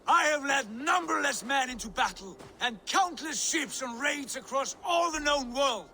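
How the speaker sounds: loud, high, furious roar at his father; wounded pride shouting down an insult. Acted. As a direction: bellow your pride back at him. Son furiously rebuts his father.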